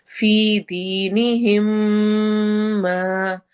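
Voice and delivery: very slow chant with long held notes; Quran recitation. Shown as a demonstration. Teacher reciting a verse to students, slow chant. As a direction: hold each long vowel out fully. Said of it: "again इदगाम शफवी है".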